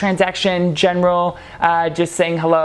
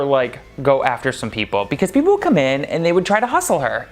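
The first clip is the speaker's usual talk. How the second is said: plain reading voice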